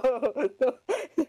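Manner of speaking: high helpless laugh; squealing laugh. Real, not acted. Friend laughing at the cat being insulted, squealing laugh. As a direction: crack up high.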